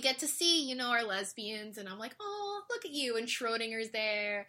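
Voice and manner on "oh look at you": high cooing. Retold or imitated. Own fond reaction to characters.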